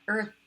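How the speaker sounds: teacher modelling the breathy th in "Earth". Shown as a demonstration. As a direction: let only air pass on the th of "Earth". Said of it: "Nothing but air coming through".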